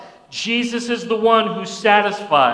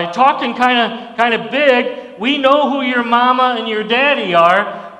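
taunting, louder and higher, jeering at Jesus; mocking crowd; 3 semitones higher, 3 dB louder than usual. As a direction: sneer like hometown hecklers. Preacher voicing mocking crowd.

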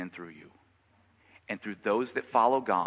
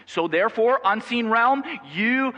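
high, loud, slow-stretched denunciation; thundering preacher ordering demons down; fierce rebuke; 11 semitones higher, 8 dB louder than usual. Acted. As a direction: berate the demons, building to a shout.

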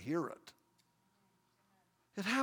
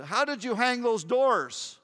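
slow, louder skeptical father asking; slow, raised, doubtful question; dad's question; 5 semitones higher, 6 dB louder than usual. Retold or imitated. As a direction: play the doubtful old carpenter dad. Dad questions his doors.

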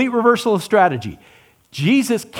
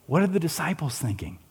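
whispered as a secret aside; whispered rhetorical question; 9 dB quieter than usual. Shown as a demonstration. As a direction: breathe "What are the disciples thinking?" with no voice.

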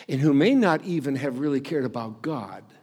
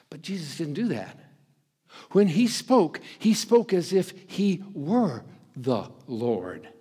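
ordinary talk, nothing marked

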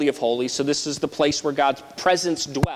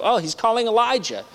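higher, scoffing "Oh, he's calling Elijah"; a scornful onlooker at the cross; mocking misunderstanding; 7 semitones higher than usual. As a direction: play the sneering bystander. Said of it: "they think he's referring to Elijah".

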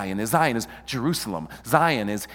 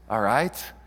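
ordinary talk

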